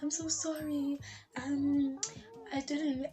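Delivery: high, whiny imitated sorry; mock apology. Apology mimicked again.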